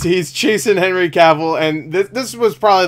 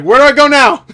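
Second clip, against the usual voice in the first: high, loud bewildered question; exasperated shout; 10 semitones higher, 9 dB louder than usual. Retold or imitated. Tom Cruise's character shouting at his guide, exasperated shout. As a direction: shout it, demanding directions. Acting out lost man being directed.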